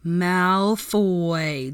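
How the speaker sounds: drawn-out name, slow; slowly pronounced. Acted. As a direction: say Malfoy slowly and pointedly. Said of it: "pronounced his name slowly".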